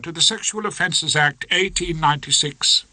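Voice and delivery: lightly voiced opposing counsel, lofty; pompous courtroom address; prosecutor voice. A narrator's reading.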